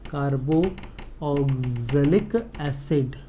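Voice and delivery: carboxylic broken into long, separate syllables; very slow dictation. Real, not acted. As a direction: drag out carboxylic as if writing each part. Repeats the term while writing.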